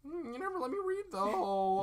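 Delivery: high, whiny wail; crying man-child. Pretend. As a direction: whine like a grown man throwing a tantrum. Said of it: "You're crying. You're a grown man".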